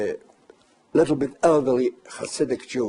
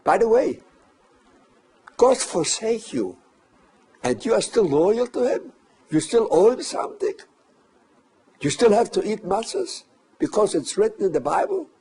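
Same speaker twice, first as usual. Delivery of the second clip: retold taunt; 8 semitones higher than usual. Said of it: "he said".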